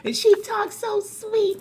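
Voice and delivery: high, lightly sugary imitation; a hint of a sweet gentle talker. Host mimicking her friend's gentle talk. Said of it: "See how she talks like this?".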